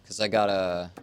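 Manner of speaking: ordinary talk, nothing marked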